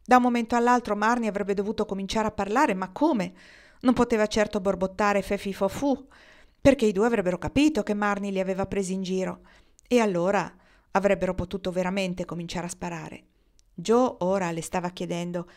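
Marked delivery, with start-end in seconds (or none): none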